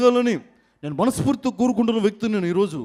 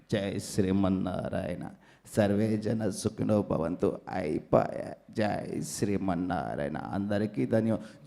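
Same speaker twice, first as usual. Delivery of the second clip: much lower, slow, solemn swami blessing; swami impression; 12 semitones lower, 8 dB quieter, slower than usual. Mimic blesses the award crowd as a much lower swami. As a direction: bless the crowd like a holy man.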